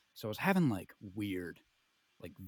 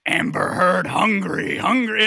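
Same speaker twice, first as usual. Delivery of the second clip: loud, higher-pitched hungry spider-monster; Amber Heard; 4 semitones higher, 8 dB louder, slower than usual. Retold or imitated. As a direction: chant it like a ravenous mock monster on the prowl. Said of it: "she starts crawling around the walls".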